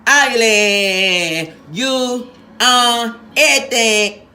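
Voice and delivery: "Ugly, you on everything" blurted loud and high, dragged slowly; hammed-up second flub; flubbed again. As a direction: mangle the line again, loud and slow. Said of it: "Now, now try that again".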